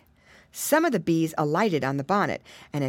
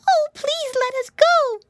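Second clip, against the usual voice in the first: very high, loud little plea; begging piglet; 6 dB louder than usual. A narrator's reading. Pinky to the wolf who holds her, begging. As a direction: beg in a high piglet squeal. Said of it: "begged Pinky".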